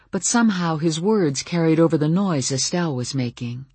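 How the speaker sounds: usual speaking voice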